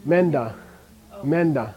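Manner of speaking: "Menda" with the n crushed into the d; prenasal Menda. Shown as a demonstration. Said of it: "How do you pronounce it?".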